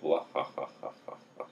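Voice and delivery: a big mock belly laugh, whispered out at the end; imitated belly laugh. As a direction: ham up her helpless laughing on the phone. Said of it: "belly laugh, like".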